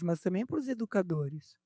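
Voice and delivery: ordinary talk, nothing marked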